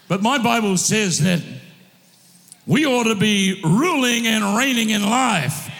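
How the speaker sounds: rousing sermon delivery, calls ringing out; fired-up preacher calling his church to rise; full-force preaching. Acted. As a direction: drive it home to the congregation. Preacher exhorting congregation.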